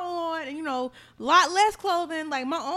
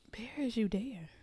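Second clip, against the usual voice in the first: whispered disbelief; 4 semitones lower, 8 dB quieter than usual. Real, not acted. Repeating an odd party name.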